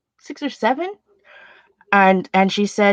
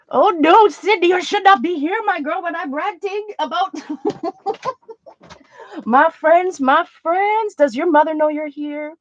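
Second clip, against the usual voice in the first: delighted teasing; 6 semitones higher than usual. Real, not acted. Niece Sydney shows up in chat.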